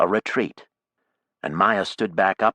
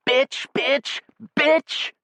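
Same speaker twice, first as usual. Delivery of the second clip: screaming much higher, louder; shout; 5 dB louder, slower than usual. A narrator's reading. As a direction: scream the insult in a brawl. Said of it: "who shouted".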